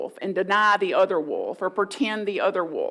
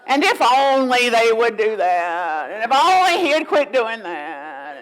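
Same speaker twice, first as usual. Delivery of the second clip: venting with a sigh; 6 dB louder than usual. Shown as a demonstration. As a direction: complain on one long, loud sigh. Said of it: "just give it some expression".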